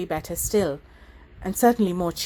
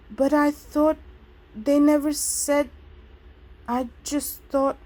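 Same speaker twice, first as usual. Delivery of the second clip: higher, halting and breaking off; faltering; 7 semitones higher than usual. A narrator's reading. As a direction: lose the thread and stop short. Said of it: "she stopped and swallowed".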